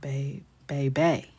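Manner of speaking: put-on "Bay" then "baby", slow and loud; careful slow pronunciation drill. Shown as a demonstration. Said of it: "It's, it's not baby".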